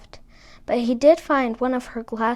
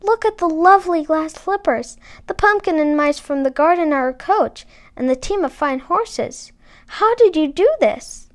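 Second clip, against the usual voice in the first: delighted; 5 semitones higher, 5 dB louder than usual. A narrator's reading. Cinderella delighted by magic.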